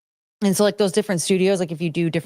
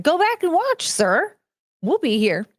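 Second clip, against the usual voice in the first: high, sassy telling-off; mock scolding; 10 semitones higher than usual. Host mock-scolding a viewer who missed the intro, sassy.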